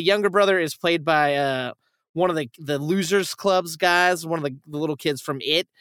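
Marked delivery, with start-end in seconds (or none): none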